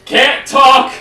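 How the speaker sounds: character voice. Acted. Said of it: "I know I did the voice badly".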